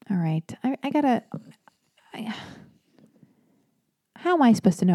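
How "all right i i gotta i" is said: normal talking voice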